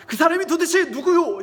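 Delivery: loud, high, furious demand; heated, forceful questioning; angry king. Acted. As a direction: demand a name in acted royal anger. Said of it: "왕이 화가 단단히 났어요".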